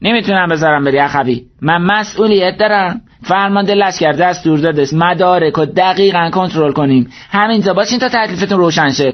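high, insistent official voice; dutiful guard. Acted. Guard holding travelers at the checkpoint, officious. Guard refuses to let them pass.

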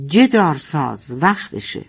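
ordinary delivery, nothing marked

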